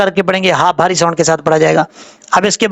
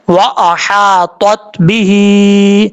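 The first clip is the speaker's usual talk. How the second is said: slow, drawn-out tajweed reading of each syllable; slow Quran recitation; much slower than usual. Shown as a demonstration. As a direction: recite the word slowly, as if teaching it. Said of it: "ता हरूफ मुस्ताला में से आप पुर पढ़ा जाएगा". (धीमी, खिंची हुई तजवीद वाली तिलावत)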